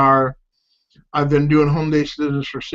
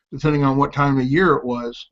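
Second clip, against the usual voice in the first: usual speaking voice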